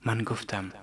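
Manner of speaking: ordinary delivery, nothing marked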